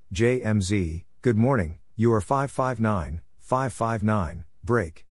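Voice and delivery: ordinary talk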